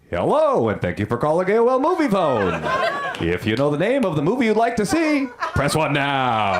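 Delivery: booming announcer with big swooping pitch; Movie Phone guy. Acted. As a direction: sell each phrase like an over-the-top phone recording. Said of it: "do a pretty good movie phone guy".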